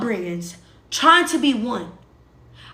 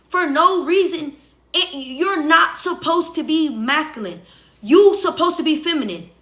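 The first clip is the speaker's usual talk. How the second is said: ordinary talk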